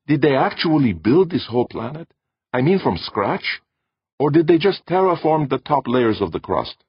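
high, eager voice of a curious woman; wondering Fire. A narrator's reading. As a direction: marvel at it, slowing on the middle question.

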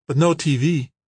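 put-on light, high girlish voice; young woman voice. A narrator's reading. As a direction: voice Kimberly bright and young. Kimberly voiced by narrator.

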